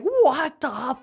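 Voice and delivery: high-pitched mock outburst at the sky; retold cursing. Man retelling his driveway meltdown, cursing at the sky. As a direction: curse upward, voice leaping high. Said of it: "and I'm cursing".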